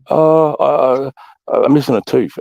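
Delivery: high, halting mock-timid report; sheepish recruit. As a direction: imitate a nervous recruit owning up. Sheepish recruit reporting his injury.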